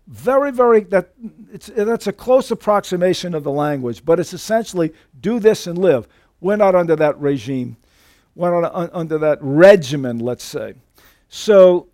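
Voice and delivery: ordinary talk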